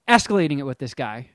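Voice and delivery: normal talking voice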